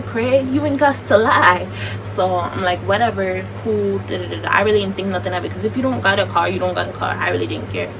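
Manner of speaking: ordinary delivery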